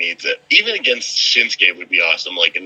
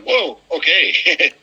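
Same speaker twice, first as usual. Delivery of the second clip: mock surprise; 8 semitones higher, much slower than usual. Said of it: "I'd been like".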